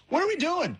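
high, exasperated coach as stepdad; stepdad catchphrase. Acted. Imagined stepdad walking in on the family, exasperated. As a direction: throw it out high-pitched, like a put-upon stepfather. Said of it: "what he would say all the time".

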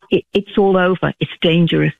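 ordinary talk, nothing marked

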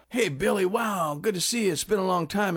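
acted-out upbeat hello; greeting a friend. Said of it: "you say".

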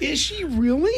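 high, rising astonished question; genuine surprise. Real, not acted. Host learning guest's wife serves too, surprised. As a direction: react with real, delighted disbelief.

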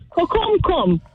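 kokumkum said in Virgin Islands Creole; island dialect word kokumkum. Shown as a demonstration. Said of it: "remember we have another word".

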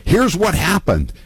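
lightly voiced Ed Markey spinning the story; mock Ed Markey. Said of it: "I pretended to be Ed Markey".

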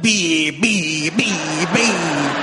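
boat horn imitation. Imitating boat horns on lake.

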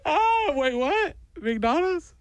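put-on very high whimpering child turning curious; mock sobs cut short by hope; tearful little-kid squeak; crying kid perking up. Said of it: "I watched this kid immediately go".

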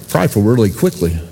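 normal talking voice

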